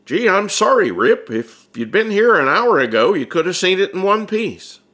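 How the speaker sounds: put-on sorry like a big awkward kid; Bud apologetic.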